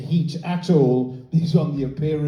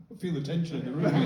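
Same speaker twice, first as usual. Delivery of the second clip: ordinary delivery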